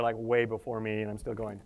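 ordinary talk

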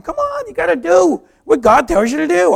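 high, loud pushy nagging; exasperated urging. Retold or imitated. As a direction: badger him at full voice. Friend pressing him, acted.

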